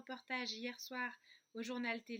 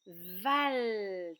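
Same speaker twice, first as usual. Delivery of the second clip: "val" loud and long drawn out; isolated syllable; 3 semitones lower, 6 dB louder than usual. Shown as a demonstration. Teacher reveals what remains of the word, loud and stretched. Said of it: "Il va me rester".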